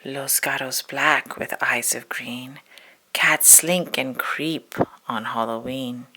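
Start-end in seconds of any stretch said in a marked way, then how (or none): none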